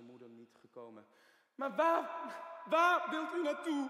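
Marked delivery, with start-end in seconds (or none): 1.5-3.9 loud, higher, defensive challenge; agitated outburst. Acted. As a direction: bristle at the question. Patient reacts to question about mother. (geagiteerd en luid)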